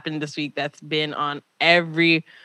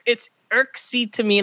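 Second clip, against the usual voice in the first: usual speaking voice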